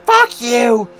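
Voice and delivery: high, loud mock yell of a furious cashier; retold angry outburst. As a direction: yell it like an offended clerk losing it. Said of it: "And he flipped out on me".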